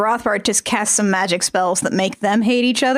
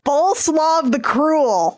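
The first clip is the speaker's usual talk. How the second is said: dramatic reveal; 5 semitones higher, slower than usual. Pretend. Dramatic history corner reveal.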